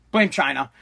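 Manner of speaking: low, soft, falling punchline; deadpan refrain. Pretend. Podcaster answering each gripe with one culprit, deadpan. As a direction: drop it low and offhand.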